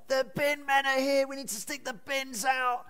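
high-pitched, urgent mock call; imitated wife's shout. Wife alerting husband that the bin men are here, imitated high shout. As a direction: imitate a wife calling out from across the house. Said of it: "the shout from my wife".

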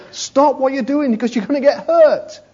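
preacher voicing a mother warning a child off the road; mock scream. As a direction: raise the voice to stop a child. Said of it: "She needed to scream at the top of her voice".

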